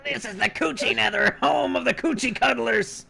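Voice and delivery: high welcoming announcer. Acted. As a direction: announce like a tour guide. Goblin tour guide voice.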